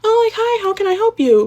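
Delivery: very high, sing-song customer-service voice; fake oblivious. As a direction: imitate someone playing innocent. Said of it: "tries to act oblivious".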